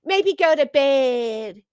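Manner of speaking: slow, sugary imitation of a coddling woman; baby her slowly, mockingly; mock coddling woman. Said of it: "damn near forty-year-old woman talking about".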